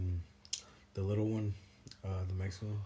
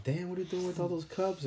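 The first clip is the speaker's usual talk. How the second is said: coworker's surprise; 8 semitones higher than usual. Retold or imitated. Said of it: "he's going like".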